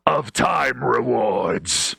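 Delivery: slow, drawn-out mock awe; hushed, raspy-edged hero proclamation; put-on announcer voice. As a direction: stretch each word as if beholding a legend.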